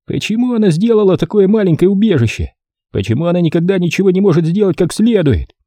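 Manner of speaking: high, contemptuous snort of a voice; scornful brother. A narrator's reading. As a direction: sneer at her failure. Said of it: "презрительно фыркал".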